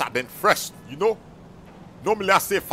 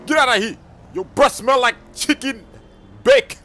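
loud, high-pitched kicking-out; irritated dismissal; 7 semitones higher, 7 dB louder than usual. Acted. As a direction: chase the pest off loudly. Throwing out the heckler.

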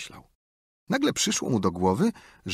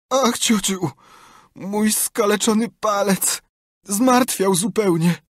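slow, high pitiful wail; feigned agony; 11 semitones higher, 4 dB louder, slower than usual. A narrator's reading. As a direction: moan out each word to the aunt. Tomek wails about toe.

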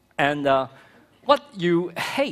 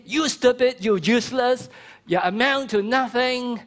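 mock scolding insults; 7 semitones higher than usual. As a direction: bark the insults like a harsh parent. Example of cursing a child.

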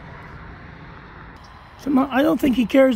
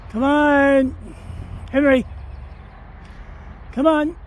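raised voice urging the dog on; calling the dog; 5 semitones higher than usual. Real, not acted. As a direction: call him over from a distance.

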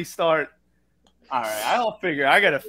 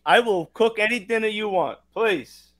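higher-pitched, hammed-up Brooklyn Italian; Brooklyn Italian accent; 5 semitones higher than usual. Host offers to cook his guest dinner, hammed-up Brooklyn Italian. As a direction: coax in an exaggerated Italian-American accent. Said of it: "Comes out all the time".

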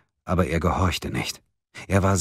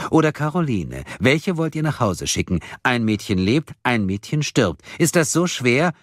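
higher, swooping, taunting voice; ice-cold villain; 7 semitones higher than usual. A narrator's reading. Kidnapper making them pick a girl, ice-cold taunting. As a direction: toy coldly with their horror. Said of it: "fragte die Stimme eiskalt".

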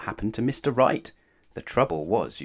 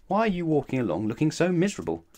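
fast, higher, brisk question; brisk Mister Right; 6 semitones higher, faster than usual. Acted. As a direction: quiz him briskly.

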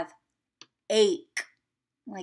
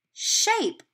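bright answer reveal; 11 semitones higher than usual. Shown as a demonstration. Teacher revealing the answer word.

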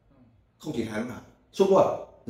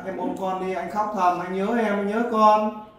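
pleading lament rising higher and louder; demo crying plea; 7 semitones higher, 5 dB louder, slower than usual. Shown as a demonstration. Host models a crying plea to wife, building to a wail. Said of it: "Khóc to lên".

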